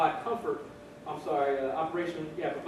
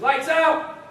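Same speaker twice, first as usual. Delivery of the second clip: loud, high shouted command; drill sergeant; 9 semitones higher, 9 dB louder than usual. Acted. Drill sergeant to the barracks at night, shouted order. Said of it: "Drill sergeant says".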